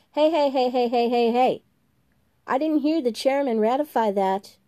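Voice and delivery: fussy February protesting. Acted. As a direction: rattle the heys to demand order.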